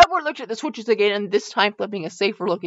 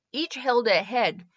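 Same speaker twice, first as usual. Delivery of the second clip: ordinary delivery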